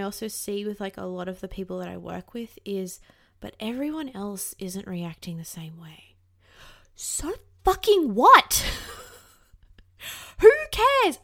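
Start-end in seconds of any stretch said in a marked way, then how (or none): 3.4-6.1 whispered, small and unsure; whispered doubt. Retold or imitated. Voicing her coworkers' doubting thought in a whisper. 6.9-11.2 louder and much higher, slow and emphatic, with a laugh; exasperated outburst. Real, not acted. Brushing off comparison with others, a loud laughing protest. Rebutting that doubt.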